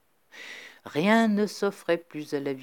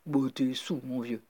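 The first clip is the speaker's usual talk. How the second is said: lightly voiced dismissive editor; 3 semitones lower than usual. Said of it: "Joe Pegler dirait simplement".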